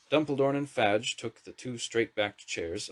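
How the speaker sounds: ordinary delivery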